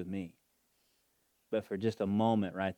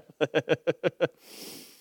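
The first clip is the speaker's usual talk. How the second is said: big hearty laugh, higher; loud laughter; 8 semitones higher, 9 dB louder than usual. Real, not acted. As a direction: burst out laughing. Laughing after joke.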